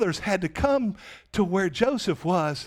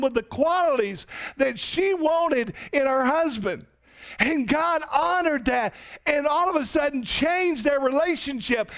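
rising excitement; 7 semitones higher than usual. Real, not acted. Preaching build.

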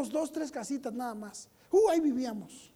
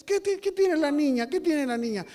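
raised voice, mimicking a doting parent; mock concerned parent; 6 semitones higher, 6 dB louder than usual. Parent rushing to a child's tantrum, mock concern. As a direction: fuss over the child, hammed-up.

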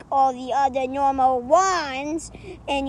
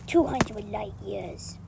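ordinary talk

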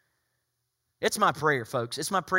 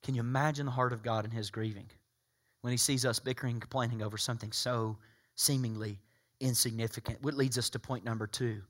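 ordinary talk, nothing marked

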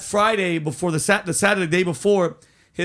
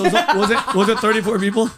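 louder, laughing disbelief; real amused laughing disbelief; 3 semitones higher, 5 dB louder than usual. Real, not acted. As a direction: laugh through the question. Laughing at tiny audience.

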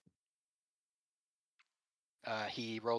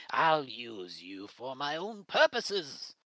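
slow, sinister villain line, louder; 5 dB louder, slower than usual. Acted. Villain gloating at the party, slow and menacing. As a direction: savor each word like a gloating villain.

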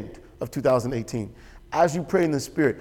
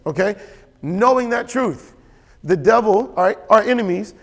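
raised, loud pulpit voice, pushing each point; a preacher driving the point home, louder and higher; full-force preaching; 4 semitones higher, 5 dB louder than usual. Acted. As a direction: preach it out to the congregation with fire.

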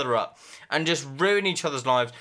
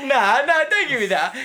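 louder, higher protest; loud dismissal; 10 semitones higher, 6 dB louder than usual. Real, not acted. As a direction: brush it off, loud. Rejecting friend's objection.

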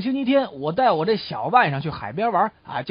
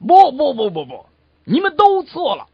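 loud, raised-pitch contradiction; fourth cowboy objecting; 5 semitones higher, 8 dB louder than usual. Acted. Fourth cowboy overruling the other three, loud objection. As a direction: cut in loudly to overrule them all. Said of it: "第四个牛仔提出的见解果然十分的独到".

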